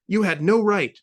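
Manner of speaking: high, loud angry bark. A narrator's reading. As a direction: bark it at him. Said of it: "barked friend".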